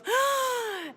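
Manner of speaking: sharp high gasp, imitating her daughter; a startled little intake of breath, high up; imitated gasp. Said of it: "and she goes".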